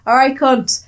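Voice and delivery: loud, high cheeky greeting; rough greeting. Retold or imitated. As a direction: bawl it at a mate across the yard. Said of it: "It was like".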